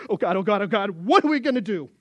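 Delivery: a kneeling leader who is losing his nerve; panicked plea. Acted. Moses kneels, panicking before God.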